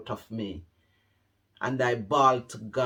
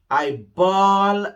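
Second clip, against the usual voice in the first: louder, higher, wailing the word bawl; 6 semitones higher, 9 dB louder than usual. Shown as a demonstration. Showing viewers how loud she wept. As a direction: let bawl ring out like a wail. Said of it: "Have you ever heard a cow bawling?".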